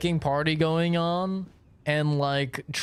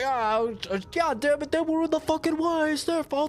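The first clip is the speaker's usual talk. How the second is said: much higher, whiny defensive driver; Trevor's voice; 3 dB louder, faster than usual. Retold or imitated. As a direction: squeak like a wired maniac blaming the cops. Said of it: "He's like".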